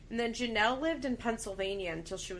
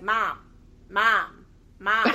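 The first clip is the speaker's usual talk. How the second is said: loud, deadpan calls for Mom; imitating a teen's blunt monotone; monotone Janelle; 8 dB louder, slower than usual. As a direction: say it loudly in an unmoved monotone, pausing between calls.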